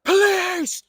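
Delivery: very high, loud desperate scream; frantic shout for police. Retold or imitated. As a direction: yell into the phone for help. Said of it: "I'm screaming on the phone".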